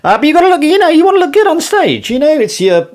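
very high, squeaky protest; flustered self-justification in a high voice; defensive. Real, not acted. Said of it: "I'm trying to defend myself".

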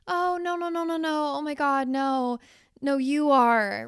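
high-pitched, rapid string of protests; hammed-up modest protest, starting high; flustered compliment deflection. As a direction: bat away praise in mock embarrassment.

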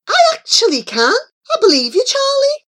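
high, loud, quick defence; indignant. A narrator's reading. Wogan siding with Charlie against the others, indignant. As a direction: stick up for a friend indignantly. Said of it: "scowling at Mosen and Flora".

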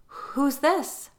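high, lilting question; careful feigned cheer. A narrator's reading. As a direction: keep it light so the child isn't scared. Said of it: "not wanting to scare Kat".

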